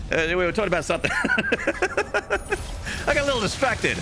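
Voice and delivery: high, giggly flustered voice; flustered laughter. Real, not acted. Streamer apologising to chat, high giggly and flustered. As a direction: giggle through the words, embarrassed. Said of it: "I got a little heated".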